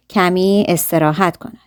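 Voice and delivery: normal talking voice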